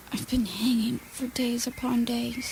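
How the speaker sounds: breathless whisper; hanging woman whispering to her rescuers. Acted. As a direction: whisper it, barely any voice left. Hanging woman begging for help.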